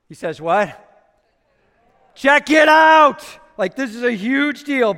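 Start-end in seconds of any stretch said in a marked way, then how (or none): 2.2-3.2 high, loud and slow shouted call; excited shout. Acted. Preacher voicing Jesus announcing, shouted. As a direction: shout it so the whole room hears.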